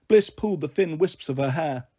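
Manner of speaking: usual speaking voice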